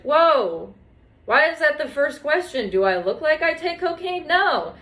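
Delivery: high, offended retort; mock outrage at the doctor; indignant. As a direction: bristle at the question, pitch up. Retelling own indignant reply.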